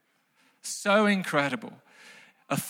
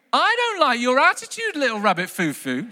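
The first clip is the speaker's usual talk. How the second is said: scolding good fairy; 5 semitones higher than usual. Acted. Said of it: "She says it like that".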